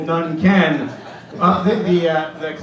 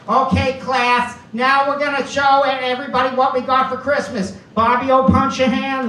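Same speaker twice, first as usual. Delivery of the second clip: nun teacher voice; 7 semitones higher, 3 dB louder than usual. Retold or imitated. Nun addressing class.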